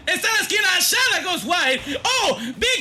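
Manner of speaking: high, rising excited call; excited shout. Real, not acted. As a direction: shout the play as it builds.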